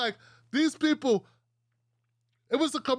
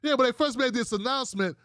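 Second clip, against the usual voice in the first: usual speaking voice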